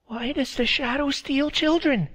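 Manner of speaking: put-on high, scared child voice; a small boy asking fearfully; frightened little boy. A narrator's reading. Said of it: "a frightened little boy asked".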